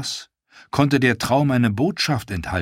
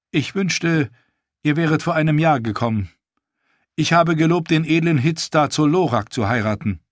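put-on higher, teasing young queen's voice; lightly higher regal queen, playful; queen Daenerys; 7 semitones higher, 3 dB louder than usual. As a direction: tease the prince, then turn to business.

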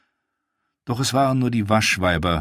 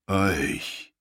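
put-on deep older woman; 3 dB quieter than usual. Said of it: "eine ältere Frau mit tiefer Stimme".

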